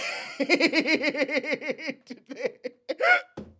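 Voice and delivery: long, high cackle; high giggling cackle; laughing fit. Real, not acted. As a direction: laugh helplessly at length. Amused reaction to a character design.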